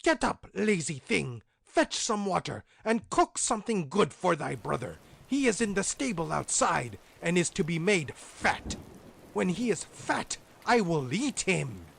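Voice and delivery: high, loud harsh witch orders; witch crying out. A narrator's reading. Witch waking Grethel with orders, high loud harsh voice. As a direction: bark orders like a cruel witch. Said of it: "and cried".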